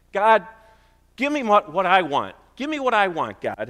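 high, loud demanding plea to God; whiny selfish prayer. Pretend. Preacher mocking a selfish prayer, demanding and whiny. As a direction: pray like a spoiled child begging. Mock self-focused prayer.

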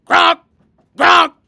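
loud, high pig gronk; two short squealed honks. A narrator's reading. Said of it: "squealed Don Cerdo".

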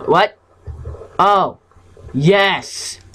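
ordinary talk, nothing marked

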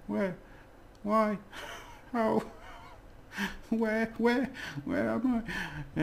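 squeaky high silly questions, laughing. Acted. Teacher clowning for students in a squeaky high voice. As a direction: play it up like a cartoon. Playful run of question words.

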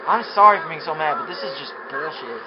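usual speaking voice

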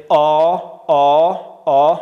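loud, slow back "آ"; long alef-madd vowel. Shown as a demonstration. Teacher drills fourth alef, loud drawn-out "آ". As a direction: hold "آ" long, as rounded "aw". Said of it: "رو به بالا".

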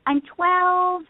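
put-on high, eager little girl on the phone; chirpy young caller; eager kid voice. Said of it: "I called and I said".

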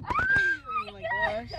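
very high, slow, drawn-out squeal; panicked squeal. Real, not acted. Panicked before the swap.

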